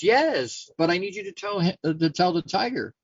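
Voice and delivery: exasperated donkey, pitched high; insistent. Acted. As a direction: plead the case with an emphatic high yes. Donkey impatiently answers the lion.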